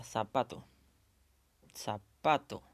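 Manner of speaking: "Zapato" said twice, stressed on the middle syllable, pa. Shown as a demonstration. Teacher pronouncing aloud to find the tonic syllable, stress on "pa" in "Zapato". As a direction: say "Zapato" aloud, putting the most force on "pa". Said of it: "la mayor fuerza de voz está en la sílaba pa".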